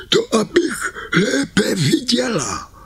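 sweeten it like a fake granny; wolf as granny.

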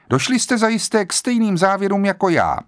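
a hint of an authoritative old judge. A narrator's reading. Judge voiced in story.

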